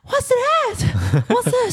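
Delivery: 惊吓地大叫; startled. Retold or imitated. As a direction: jump out of sleep in shock. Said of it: "然后我就整个眼睛像吓到".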